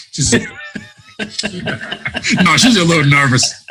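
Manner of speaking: high breathy laughter around the words; laughing. Real, not acted. Husband laughing as he retells his wife's reaction.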